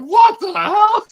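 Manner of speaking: very high, slow shriek; imitating Nikki. Dad mimics Nikki's shocked reaction, very high and drawn out. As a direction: squeal it out in disbelief, very high.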